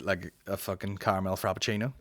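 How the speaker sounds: normal talking voice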